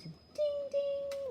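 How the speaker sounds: high ringing ding ding; high sustained page-turn chime; imitated chime. Shown as a demonstration. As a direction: sing out a little bell sound, held and ringing.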